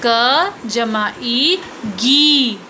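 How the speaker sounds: धीरे धीरे उच्चारण; slow spelled-out sounds. Shown as a demonstration. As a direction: slowly, sound by sound. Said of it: "उच्चारण के साथ समझेंगे".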